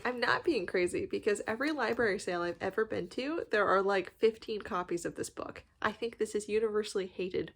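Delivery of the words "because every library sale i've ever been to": ordinary delivery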